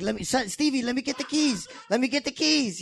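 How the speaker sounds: high, softened pleading; acting out a pleading film character; imitated movie plea. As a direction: plead up high without raising the volume. Acted movie quote, pleading.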